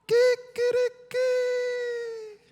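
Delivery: very high, slow, drawn-out falsetto; Peter's cursing turned into a comic high squeal; shocked squeal. Acted. Mock outraged cursing Peter.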